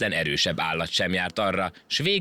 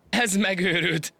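mocking laugh; 12 semitones higher, slower than usual. A narrator's reading. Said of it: "teli torokból nevetni kezdett".

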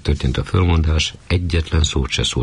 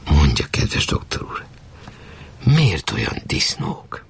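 whispered, leaning in close; confiding whisper; slower than usual. Acted. Viziné whispers her question to the doctor. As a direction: hush it like a secret meant only for him.

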